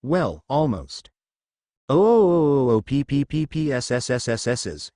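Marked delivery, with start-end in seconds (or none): 1.9-4.9 stuttering spluttering ghost noises; sputtering ghost. Acted. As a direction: sputter and fizzle out. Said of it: "it muttered, then coughed".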